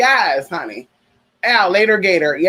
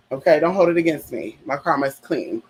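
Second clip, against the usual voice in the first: usual speaking voice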